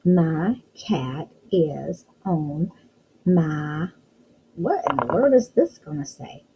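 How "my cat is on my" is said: My cat is on read word by word very slowly; slow sounding-out reading. Shown as a demonstration. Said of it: "So let's read".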